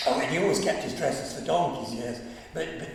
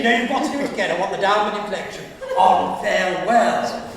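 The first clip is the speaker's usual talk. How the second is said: coaching voice; 3 semitones higher, 8 dB louder, faster than usual. Retold or imitated. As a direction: push the correction with energy. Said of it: "he would say to me".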